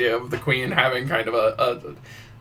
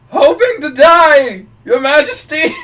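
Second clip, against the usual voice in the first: much higher, louder, slow pleading; mortified comedian; 10 dB louder, slower than usual. Retold or imitated. Comedian answering the queen, high, loud and slow. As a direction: declare it in grand terror. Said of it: "retold comedian reply".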